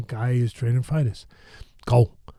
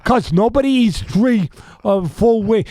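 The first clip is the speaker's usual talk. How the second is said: high, slow, drawn-out protest; imitated scolding, pitched way up; exasperated objection; 9 semitones higher, 4 dB louder, slower than usual. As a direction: argue back in mock exasperation. Camille arguing back with Cus.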